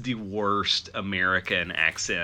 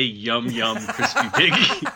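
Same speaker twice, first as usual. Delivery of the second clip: laughing fake Southern. Co-host quoting the crispy piggy line at barbecue, laughing mock Southern. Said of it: "I also say".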